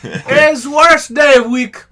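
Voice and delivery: loud, slow bad South African accent; hammy cartoon South African accent; botched South African accent. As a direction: stretch each word in a fake South African accent. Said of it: "Why is he South African".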